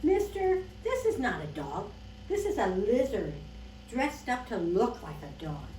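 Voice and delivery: put-on a touch of exasperated child; indignant little girl. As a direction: call out sharply, then correct him firmly. Girl objects to fake dog.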